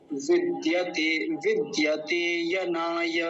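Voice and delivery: विद्यते यनाय in slow, separate syllables; pronunciation demo. Shown as a demonstration. Teacher finishing the pronunciation lesson, slow careful demo. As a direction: lengthen the vowels of विद्यते यनाय. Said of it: "ऐसा इसका उच्चारण हो गया".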